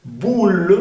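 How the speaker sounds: emphatic "bool" for "Boule"; U sound. Shown as a demonstration. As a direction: round the lips for a long "Boule". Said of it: "sonido U".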